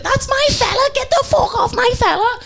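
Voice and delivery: high-pitched, mock-irate Scouse woman; Scouse accent. Woman joining the bar fight to defend her fella, screeched in a Scouse accent. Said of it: "do this ghost accent again".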